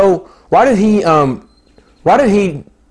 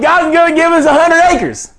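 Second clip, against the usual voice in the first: higher, laughing proclamation of faith; laughing declaration; 12 semitones higher than usual. Retold or imitated. Preacher quoting his own promise, laughing. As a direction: declare it while laughing with joy. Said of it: "I started laughing. I was like".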